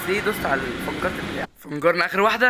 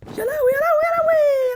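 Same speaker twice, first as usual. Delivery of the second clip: much higher, louder frantic yelp; panicked shriek; 6 dB louder than usual. Real, not acted. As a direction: squeal in real panic as the danger hits. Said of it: "انا خايف، انا خايف".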